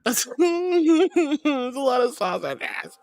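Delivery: high, slow words through giggles; helpless laughing. Real, not acted. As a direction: try to talk while cracking up. Cracking up at sausage sight gag.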